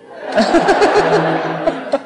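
loud high burst of laughter; a real high laugh; big laugh. Real, not acted. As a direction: crack up out loud. Laughs at fishing line magic.

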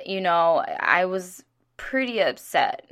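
ordinary delivery

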